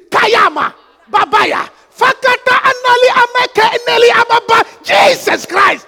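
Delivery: forceful shouted praying in tongues; screamed prayer. Shown as a demonstration. Preacher showing prayer when danger comes, full shout. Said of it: "You scream".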